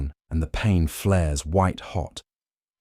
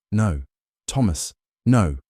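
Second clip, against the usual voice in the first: firm; 3 semitones higher than usual. A narrator's reading. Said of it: "you say more firmly".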